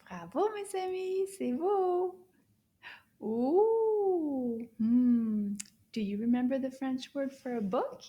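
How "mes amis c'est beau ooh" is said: ordinary talk, nothing marked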